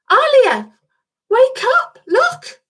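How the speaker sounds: put-on high, excited child's voice calling; excited angel. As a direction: wake her up in a rush of excitement. Angel wakes friend excitedly.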